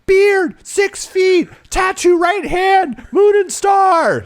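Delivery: very high, slow, loud scream; yelling. Retold or imitated. Kidnapped daughter describing her attackers to dad, yelling. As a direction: scream each detail out, drawn out and high. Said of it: "And she yells".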